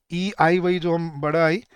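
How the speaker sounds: usual speaking voice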